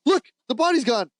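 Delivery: high-pitched startled cry of discovery; mock alarm. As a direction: imitate a shocked call to the others. Retold discovery of missing body.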